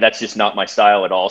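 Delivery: ordinary delivery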